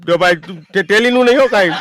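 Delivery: high, loud, quick rebuke; loud fast warning. Retold or imitated. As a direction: cut him off sharply. Neighbour warns him loudly.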